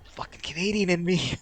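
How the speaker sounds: exasperated grumble. Real, not acted. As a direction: curse your own nature, still holding it in. Exasperated at own Canadian urge.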